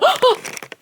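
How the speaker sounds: a sudden high gasp of shock; sharp gasp. Real, not acted. Catching the dog with pants, shocked gasp. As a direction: gasp at what the dog has.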